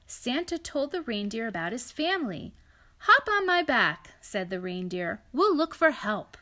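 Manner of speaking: storybook read-aloud, lightly lilting; reading to children. A narrator's reading. Parent reading a picture book to a child, gentle storytelling. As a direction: read it to a little one beside you.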